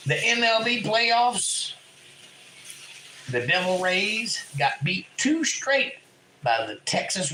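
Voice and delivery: punchy, theatrical sports-update read with big pitch jumps; mock sports announcer. Host throws out a playoff update for chat, hammed-up announcer. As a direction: ham up a radio sports bulletin, pausing between phrases.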